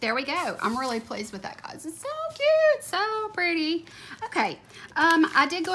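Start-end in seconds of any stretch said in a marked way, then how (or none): 1.9-3.9 high squeaky coo, then slower; high cutesy gushing. Real, not acted. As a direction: gush over it in a tiny cute voice. Cooing over cute stickers.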